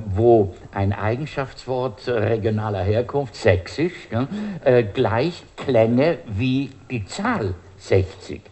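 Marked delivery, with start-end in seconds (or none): none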